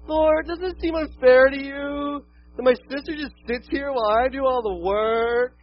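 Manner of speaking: high, loud, slow whining plea; whiny child's complaint. Retold or imitated. Martha whining to Jesus about her sister, slow and shrill. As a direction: whine like a put-upon kid to Jesus. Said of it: "that's a quote from my children".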